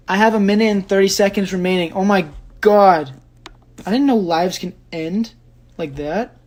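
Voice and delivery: startled, high and loud; alarmed surprise. Real, not acted. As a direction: blurt it out on noticing time running out. Discovers the live will cut off.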